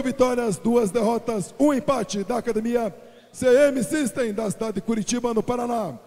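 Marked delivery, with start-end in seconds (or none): none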